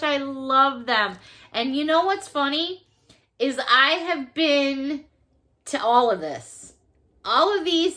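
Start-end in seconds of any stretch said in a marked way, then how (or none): none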